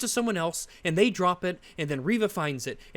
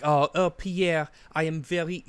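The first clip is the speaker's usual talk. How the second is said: put-on French accent; 2 semitones lower than usual. French Resistance member messaging Pierre, in a mock French accent. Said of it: "'cause I'm French, this is how they talk".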